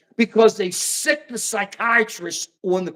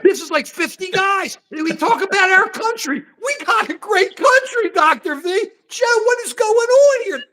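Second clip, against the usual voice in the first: outraged shout; 9 semitones higher than usual. Real, not acted. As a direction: raise your voice in disbelief. Outraged rant at cohosts.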